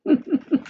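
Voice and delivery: a little scheming laugh; pleased trickster chuckle; sly chuckle. Acted. Sly laugh after deal.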